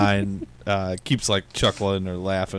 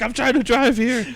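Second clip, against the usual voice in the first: high, pitched-up complaint; imitating a flustered driver, way up high; mock exasperated; 14 semitones higher than usual. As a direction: whine it at the guy in the back seat. Retold action scene, complaining driver.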